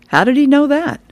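put-on high, curious kid voice; little boy voice. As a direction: ask it like a wide-eyed young boy. Child Nelson asks grandpa.

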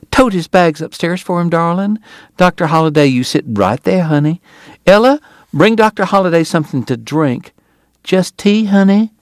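high lady's voice giving sweet orders; doting wife playing hostess; bustling Southern hostess. A narrator's reading. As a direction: boss everyone fondly, pitched high.

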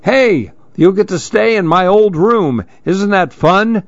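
high, bright, coaxing dad voice; cheery dad. Acted. The dad cheering Lil about Grandma's trip, upbeat coaxing. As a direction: sell it to her like an upbeat father. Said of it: "That's her dad".

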